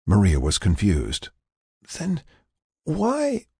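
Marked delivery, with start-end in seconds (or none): 1.9-3.5 weak, halting patient. Acted. Confused patient struggles to ask, halting high voice. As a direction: stop mid-question, voice feeble. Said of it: "She had to stop and swallow".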